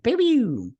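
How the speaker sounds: high cartoon pew pew; mock blaster shots. Crosshair shooting the scientist, high playful pew pew. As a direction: fire off two silly laser sounds. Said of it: "He's like".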